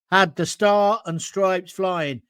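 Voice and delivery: usual speaking voice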